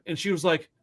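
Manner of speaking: usual speaking voice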